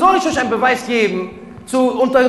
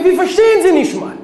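emphatic; 10 semitones higher, 8 dB louder than usual. Acted. Preacher's loud then hushed repetition.

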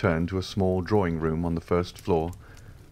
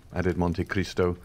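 ordinary delivery, nothing marked

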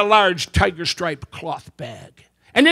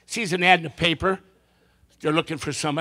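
ordinary talk, nothing marked

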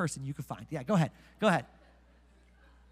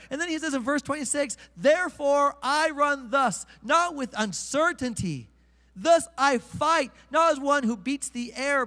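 higher, slow, building pulpit fervor; fervent preaching; 5 semitones higher, slower than usual. Acted. Pastor urges the congregation to run harder, fervent preaching. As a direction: preach it high and slow, driving it home.

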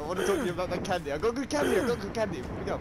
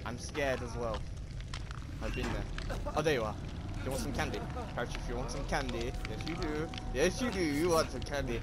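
creepy candy man; 5 semitones lower than usual. Pretend. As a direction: lure them in like a creepy stranger with sweets. Candy voice again.

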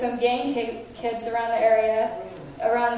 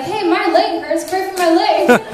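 higher, louder imitation of an eager kid; mock urgent plea; retold eager demand; 6 semitones higher, 6 dB louder than usual. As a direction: call out the request, lightly acted.

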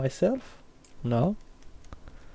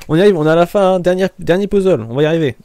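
excited cheering; 3 semitones higher, 7 dB louder, much faster than usual. Real, not acted. Cheering self on, final puzzle.

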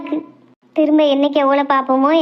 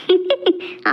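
short quiet giggle; a little amused laugh at the question; soft laugh. Acted. Daughter amused by her mother's scolding, soft laugh. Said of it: "எனக்கு சிரிப்பு தான் வருது".

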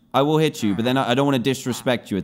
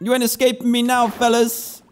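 mock villain taunt; 9 semitones higher than usual.

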